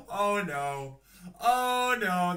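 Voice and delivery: loud laughing groans of dismay. Real, not acted. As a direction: laugh through the words. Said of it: "I'm laughing because".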